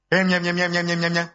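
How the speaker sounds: monotone run of "em" sounds; mock mumbled answer. Preacher mimicking the congregation's answer, monotone mumbling.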